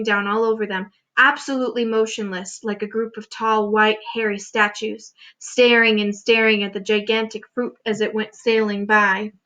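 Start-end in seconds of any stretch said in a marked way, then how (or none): none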